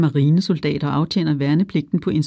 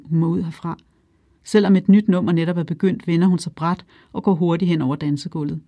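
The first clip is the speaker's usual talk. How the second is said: usual speaking voice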